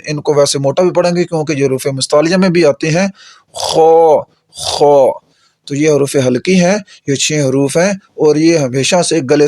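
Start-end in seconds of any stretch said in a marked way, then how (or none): 3.5-5.2 خا rasped from the upper throat; teacher sounding out a throat letter; demo of kha. Shown as a demonstration. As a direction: say خا with friction at the tongue root. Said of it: "گلے کے اوپر والے حصے سے ادا ہوتے ہیں".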